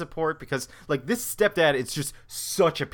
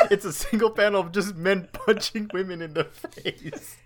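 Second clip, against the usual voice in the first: ordinary talk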